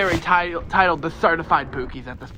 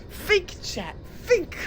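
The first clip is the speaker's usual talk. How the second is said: high squeaky dramatic plea; 12 semitones higher, 6 dB quieter, slower than usual. Acted. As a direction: squeak it out at chat. Rhetorical challenge to chat.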